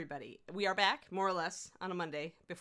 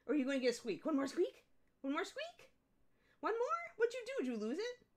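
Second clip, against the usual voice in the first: much higher coaxing questions to the dog; 9 semitones higher than usual. Real, not acted. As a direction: tease the puppy in a high sing-song. Baby talk to dog.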